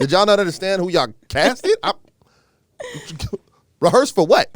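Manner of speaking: loud, high, laughing mock outrage; hammed-up disbelief, breaking into laughter; trash talking. Former player told he needs rehearsals, comic trash talking. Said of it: "Your trash talking's already starting".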